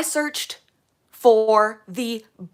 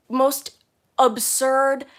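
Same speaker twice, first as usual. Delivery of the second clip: ordinary talk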